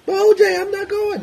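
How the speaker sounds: very high, loud mock woman's voice; wife's protest. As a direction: squeal back at the husband. Wife voiced in acted quarrel.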